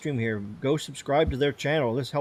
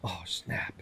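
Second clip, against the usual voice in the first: whisper; 8 semitones lower, 15 dB quieter than usual. Real, not acted. Whispered reaction.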